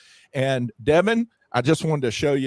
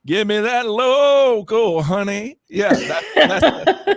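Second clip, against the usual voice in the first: playful hype; 8 semitones higher, 4 dB louder, much slower than usual. Real, not acted. Playful pitch for local honey.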